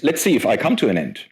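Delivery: ordinary talk, nothing marked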